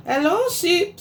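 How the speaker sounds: high bright chirpy hello; cheery duck greeting. Acted. Duck calls out to Cow as he rides past, high and cheery. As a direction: greet like a happy little duck on a bike.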